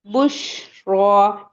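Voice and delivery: बुशरा very slow and loud, the श spread through the mouth; spread sh sound. Shown as a demonstration. Teacher shows the spreading श to students, slowly. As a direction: let the श of बुशरा fan out slowly. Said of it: "शीन में तफशी".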